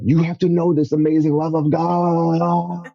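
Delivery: high, slow, gushing plea to his friend; begging his buddy to feel God's love, voice way up; excited urging. Retold or imitated. Teen urging friend at conference.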